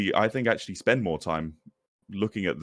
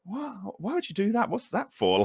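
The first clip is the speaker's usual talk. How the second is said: incredulous. Retold or imitated. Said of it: "I used to sit there going".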